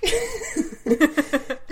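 high burst of laughter; laughing. Real, not acted. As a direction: laugh at your own joke. Laughs at own book joke.

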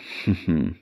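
short acted chuckle; storyteller's little character laugh; knowing chuckle. A narrator's reading. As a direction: chuckle briefly, as if plotting. Old man chuckles at angry queen.

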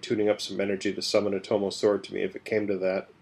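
ordinary delivery

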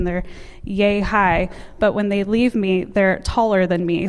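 normal talking voice

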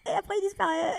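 high laughing voice. Real, not acted. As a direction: laugh through the punchline. Dark joke, laughing. (en riant, voix aiguë)

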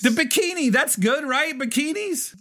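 high-pitched, eager insistence; excited. Real, not acted. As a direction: pitch way up, playfully defensive. Insisting bikinis count as good.

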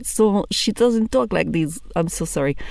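ordinary talk, nothing marked

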